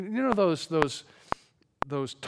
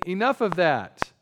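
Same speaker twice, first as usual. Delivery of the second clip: raised, firm cut-off; stern rebuke; 6 semitones higher, 7 dB louder than usual. Acted. God tells Moses to stop asking, stern and raised. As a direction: shut the pleading down loudly.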